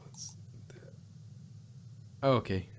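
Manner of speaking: ordinary talk, nothing marked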